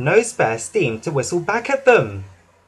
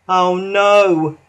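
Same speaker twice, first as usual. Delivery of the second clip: moaning; 4 semitones higher, 4 dB louder than usual. A narrator's reading. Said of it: "Thomas moaned".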